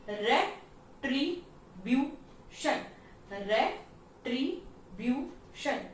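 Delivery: Retribution slowly split as re-tri-bu-tion; four separate beats with gaps in retribution; syllable by syllable. Shown as a demonstration. Teacher showing four-syllable words, syllable by syllable. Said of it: "four सिलाबे वाले".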